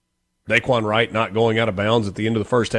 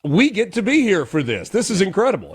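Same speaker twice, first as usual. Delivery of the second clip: high-pitched thrill at usual volume; delighted, awed; 8 semitones higher than usual. Retold or imitated. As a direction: imitate announcers thrilled just to be there. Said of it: "They were enjoying it as well".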